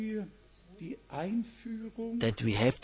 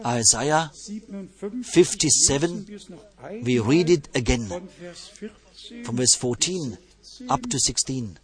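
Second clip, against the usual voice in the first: ordinary talk, nothing marked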